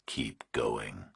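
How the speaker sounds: slow, low, menacing whisper; distorted nightmare voice. A narrator's reading. Kidnapper on the phone to Burke, slow eerie whisper. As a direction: breathe it like a monster on the line.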